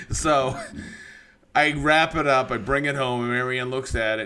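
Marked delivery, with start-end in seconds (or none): none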